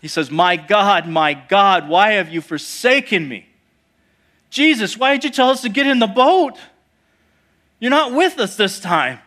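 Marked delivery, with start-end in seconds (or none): 0.3-3.5 loud, acted cry to God. David crying out to God in his psalm, loudly. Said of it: "when he cries out and he says". 4.5-9.2 high, loud, acted complaint; desperate protest. Disciples in the boat challenging Jesus, loud desperate protest.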